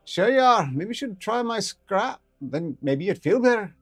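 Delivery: high ferret voice, sly and slow at the end; teasing ferret. Acted. Ferret teasing coughing doctor, high and sly. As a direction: needle him gently, high.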